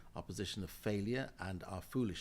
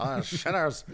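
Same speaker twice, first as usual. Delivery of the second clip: louder Ulster Paisley impression on Shinners; Paisley's Ulster accent; 5 semitones higher, 9 dB louder, slower than usual. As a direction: ham up Paisley's Northern Irish growl. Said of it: "It's a very good accent".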